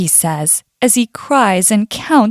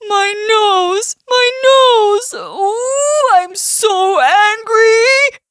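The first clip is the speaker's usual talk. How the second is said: high, wailing storybook outrage; stung bear howling, words stretched; hammed-up furious cry of pain; growling angry bear; 10 semitones higher, much slower than usual. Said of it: "growls Mister Bear".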